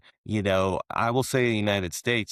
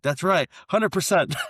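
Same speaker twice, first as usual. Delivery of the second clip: higher, proud reveal of the cut; punchline boast; 10 semitones higher, 4 dB louder than usual. Retold or imitated. Mexican politician bragging about his cut, higher and proud. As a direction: land the joke's punchline. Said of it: "He's like".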